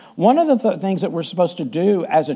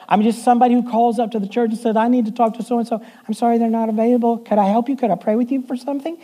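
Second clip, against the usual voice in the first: quick, high sweet phone voice; talking like the secretary; 3 semitones higher, faster than usual. Retold or imitated. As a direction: chirp it like a sweet church receptionist. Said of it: "I'm talking like she talks right now".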